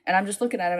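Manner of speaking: ordinary talk, nothing marked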